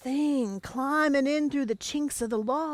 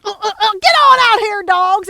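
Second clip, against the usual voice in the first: loud hollering call, higher; calling the dogs; 8 semitones higher, 16 dB louder, faster than usual. Acted. Man hollering out the door for his dogs, loud call.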